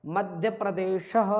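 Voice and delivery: "मध्य प्रदेशः" slow, ending sha-ha; slow model of "मध्य प्रदेशः"; visarga sounded as "ha". Shown as a demonstration. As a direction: say "मध्य प्रदेशः" slowly with an audible final ha. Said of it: "हा का उच्चारण".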